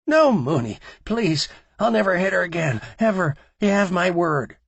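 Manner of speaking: high, loud frightened begging; pleading in terror. A narrator's reading. Terrified man pleading at gunpoint.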